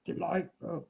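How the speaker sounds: lightly voiced lion, dropped low. Shown as a demonstration. Teacher modelling the line for a child, low lion voice. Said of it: "say this like a lion".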